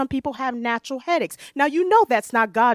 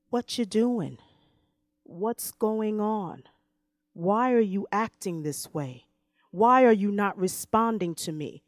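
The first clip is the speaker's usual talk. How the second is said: gentle questioning; 2 semitones lower, 5 dB quieter than usual. Acted. God's gentle questions voiced.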